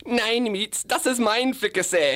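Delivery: high-pitched German cartoon voice; put-on high German accent; German South Park. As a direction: squeal it like a German-dubbed cartoon. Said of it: "doing the German version of South Park".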